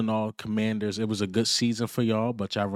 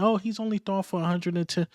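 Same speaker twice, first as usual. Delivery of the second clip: high, quick mimicry of doubters; quick high parody of the doubters; mocking critics; 10 semitones higher, faster than usual. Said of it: "I kept saying".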